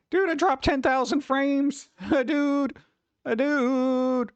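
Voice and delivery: high, stunned dude; can't believe the dropped frames; disbelief. Real, not acted. Streamer stunned at ten thousand dropped frames, disbelief.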